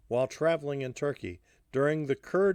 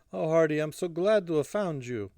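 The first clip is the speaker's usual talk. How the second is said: put-on higher-pitched, relieved Russian-accented voice; 6 semitones higher, 3 dB louder than usual. A narrator's reading. Alla embraces Hardy on finding him, Russian accent. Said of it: "of her Russian accent".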